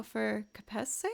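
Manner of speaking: ordinary delivery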